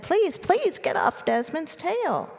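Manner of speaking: high, slow, drawn-out plea; pleading mice. A narrator's reading. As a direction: beg in high little mouse voices.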